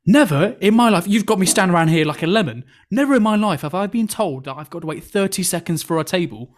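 loud, high outburst on the opening words; retold rant of an outraged diner; indignant customer. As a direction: complain with mock outrage, punching the first words. Irate customer's complaint, retold.

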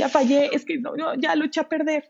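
half-hearted wail of a guilt-ridden mom; guilty mom. Retold or imitated. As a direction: act the mom sure she ruined it. Mothers voicing guilt, acted.

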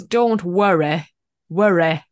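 mimicked Herefordshire worry. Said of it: "some words that you say".

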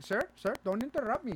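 high, loud mock indignation; heated scam caller. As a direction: cut in as an exasperated agent. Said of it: "heated 'cause she's like".

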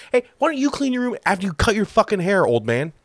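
high-pitched, acted backtalk to a dad; mock indignant retort. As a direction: snap back in mock outrage. Acted comeback to top-knot dad.

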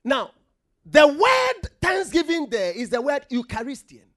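loud, high, driving sermon voice; full-force preaching. Acted. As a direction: preach it out to the whole church.

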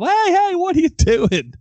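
high, loud retold yell across the yard; yelling at wife. Husband yelling at wife mowing down the sidewalk, loud alarmed shout. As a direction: yell to stop her mid-mow. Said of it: "And so I yell".

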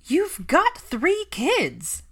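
high, loud, drawn-out scoff. A narrator's reading. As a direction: scoff in disbelief, stretching the words. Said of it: "Pansy scoffed".

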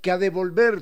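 Ecuadorian highland speech. Shown as a demonstration. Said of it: "cuando decimos".

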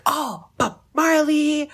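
loud, high, drawn-out cry of recognition; stretched-out, delighted mock cheer; starstruck exclamation. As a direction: imitate fans greeting a celebrity. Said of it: "they were just like".

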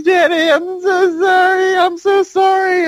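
high-pitched, wailing fake cry; hammed-up weepy daughter at a casket; mock sobbing. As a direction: blubber it out in an exaggerated falsetto. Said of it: "She goes back down to Al's casket".

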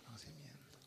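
sudden whispered word; dramatic whisper. Acted. Preacher whispering the key word to the church. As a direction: drop to a breath for effect. Dramatic drop after loud build.

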